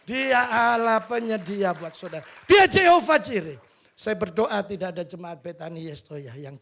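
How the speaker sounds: loud, rising pulpit proclamation; preaching at full force. Acted. Pastor proclaiming God as provider to the congregation, loud. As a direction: proclaim it to the whole church.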